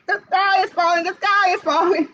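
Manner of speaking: panicky chickens. Acted. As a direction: flap and squawk it.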